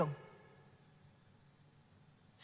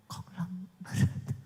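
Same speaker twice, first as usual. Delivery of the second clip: whisper; 6 semitones lower, 4 dB quieter, much slower than usual. Acted. Whispered mock.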